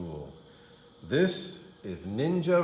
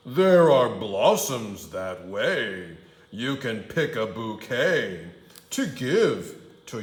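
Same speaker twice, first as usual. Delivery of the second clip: sly wolf; 6 dB louder than usual. Acted. Wolf coaxing Red toward the blossoms. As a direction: lure her off the path.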